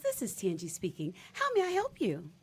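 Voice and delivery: bright chirpy receptionist voice; cheery phone greeting, climbing up. Pretend.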